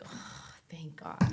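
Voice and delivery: slow, breathy whispered relief; relieved whisper. Retold or imitated. Mother hearing no case opened, slow relieved whisper. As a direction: let it out as a whispered sigh of relief. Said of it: "I was just like".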